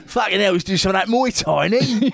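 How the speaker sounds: high-pitched, hammed-up Cockney; Cockney accent. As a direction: joke along as a dubbed Cockney fighter. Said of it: "they made him a Cockney".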